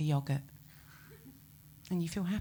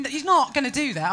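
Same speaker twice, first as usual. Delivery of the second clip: normal talking voice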